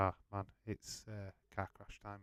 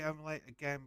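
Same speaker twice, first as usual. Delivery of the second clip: ordinary delivery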